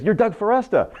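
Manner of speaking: high-pitched imitation of an amazed fan; surprised recognition. Stranger recognizes the radio host in a bookstore, acted amazement.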